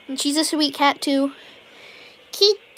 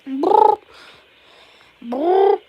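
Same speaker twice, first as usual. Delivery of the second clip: high, loud rolled brr; cat trill; 8 semitones higher, 6 dB louder than usual. Pretend.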